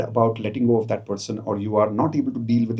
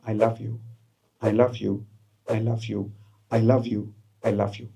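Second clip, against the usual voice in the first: quieter, tender repetitions; soft loving affirmation; 3 semitones lower, 5 dB quieter than usual. Shown as a demonstration. Coach guiding love toward family, soft and tender. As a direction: say it softly to each loved one. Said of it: "Take five such people in your mind and say".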